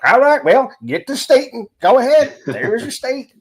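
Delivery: high, loud mocking Southern accent. Mocking his own colleagues in a loud, high Southern accent. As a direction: ham up a Southern accent to poke fun at your own side. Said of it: "put it in the Southern voice".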